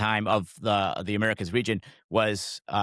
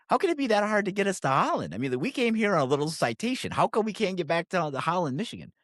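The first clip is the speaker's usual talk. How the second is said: higher, puzzled exasperated pitch; how hard can this be, said higher; baffled exasperation; 7 semitones higher than usual. Retold or imitated. Retelling the group's baffled thoughts.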